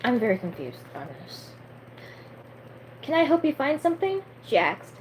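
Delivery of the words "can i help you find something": character dialogue. A narrator's reading. Reading the daughter's dialogue in story.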